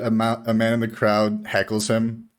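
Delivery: ordinary talk, nothing marked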